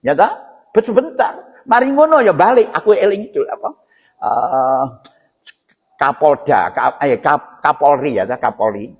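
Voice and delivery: ordinary delivery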